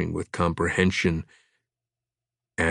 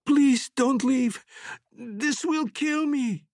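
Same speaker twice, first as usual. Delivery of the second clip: high, slow fading plea, dropping to soft; dying woman begging the monster not to leave her; desperate begging; 5 dB quieter, slower than usual. Acted. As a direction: beg him not to leave, voice failing.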